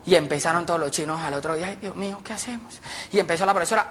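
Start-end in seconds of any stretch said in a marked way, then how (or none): 1.7-2.8 very soft, hushed fretting; worried murmur. Acted. Pupils confer anxiously before roll call, worried murmur. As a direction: fret under your breath.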